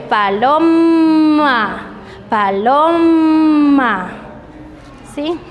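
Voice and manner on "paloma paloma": "paloma" very slow, the M hummed long; stretched M. Shown as a demonstration. Teacher to students, humming the M sound. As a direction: draw out the M in "paloma". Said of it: "La M".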